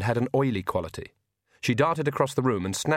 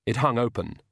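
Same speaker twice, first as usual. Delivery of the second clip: usual speaking voice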